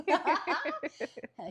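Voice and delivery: loud, high burst of laughter; laughing. Real, not acted. Laughing hard at the host's joke, loud and high. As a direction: crack up out loud. Laughter continues after love.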